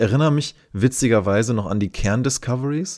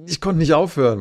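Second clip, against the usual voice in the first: hooked friend; 6 semitones higher, faster than usual. Retold or imitated. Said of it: "Und er so".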